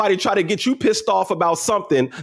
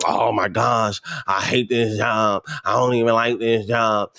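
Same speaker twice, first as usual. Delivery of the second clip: lower, moaning coworker; mock the complainer at work; whiny complainer; 8 semitones lower, slower than usual.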